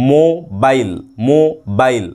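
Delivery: Mobile split into মো, pause, bile; syllable break. Shown as a demonstration. Teacher shows students two syllables, mobile broken after মো. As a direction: break mobile after মো, each part on its own. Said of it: "মো বলে কিন্তু থামতে হচ্ছে".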